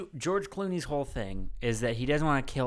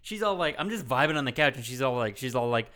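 ordinary talk, nothing marked

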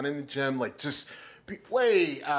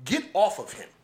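higher, pushy command to a fellow cop; urgent command; 7 semitones higher than usual. Retold or imitated. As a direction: pull him off, now. Officer voiced stopping Chauvin.

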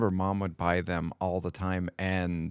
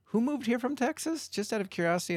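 lightly voiced police officer, pitched high and rising; a hint of a nosy cop asking around; curious officer. Retelling the officer quizzing the teachers, mildly mock curious.

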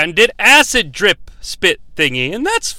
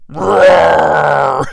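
drawn-out mock monster roar; imitated roar; 4 semitones lower, 3 dB louder than usual. Host imitates Scorpion in hell, mock roar. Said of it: "Scorpion in hell just going".

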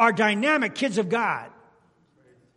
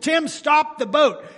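retold shout; 7 semitones higher, 6 dB louder, slower than usual. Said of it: "I finally said".

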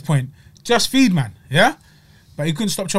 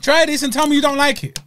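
begging viewers, voice shooting up high; high pleading repeat; 9 semitones higher, 3 dB louder than usual. Real, not acted. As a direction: pitch jumps way up, pleading. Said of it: "I implore you guys".

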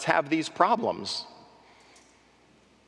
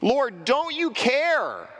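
slow, higher, pleading complaint; accusing plea; 7 semitones higher, much slower than usual. Acted. Martha accusing the Lord, slow and pleading. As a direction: drag it out like a hurt accusation. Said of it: "Martha's accusation".

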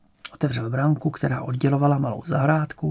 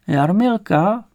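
put-on higher, slow old woman; old woman's voice; 6 semitones higher, 5 dB louder, much slower than usual. A narrator's reading. Grandmother recognising granddaughter's photo, high slow old voice. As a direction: confirm slowly in an old woman's voice.

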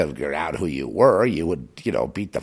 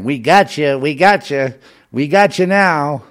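higher, louder mocking chant; taunting gloat; 7 semitones higher, 7 dB louder than usual. Strangers in Walmart taunting him, loud mocking gloat. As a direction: gloat at them like a mob.

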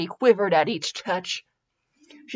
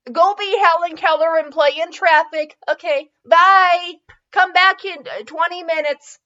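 joking shoo-away; 8 semitones higher, 5 dB louder than usual. Real, not acted. Shooing someone out, joking.

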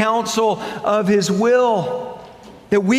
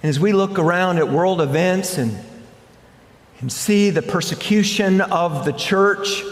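ordinary delivery

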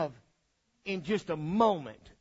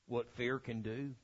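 usual speaking voice

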